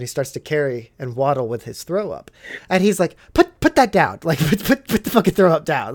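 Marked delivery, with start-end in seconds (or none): 3.3-5.9 retold alarmed order. Officer to sick private holding throw-up, mock alarmed order. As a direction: act out an urgent, stumbling order.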